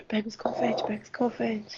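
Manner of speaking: hushed urgent whisper. Real, not acted. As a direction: whisper so he won't hear. Secret surprise preparations.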